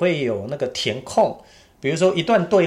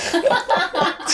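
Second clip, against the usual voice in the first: high laugh. Real, not acted. Laughing at own accent impression.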